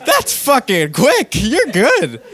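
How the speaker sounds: high, laughing through the words; cracking up while talking. Real, not acted. Laughing at the absurd story.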